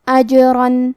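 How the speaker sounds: اجرن with a bounced jeem; qalqala on jeem. Shown as a demonstration. Teacher demonstrates qalqala to students, bouncing jeem. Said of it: "حرف کو ہلاتے ہوئے یہ کلمہ".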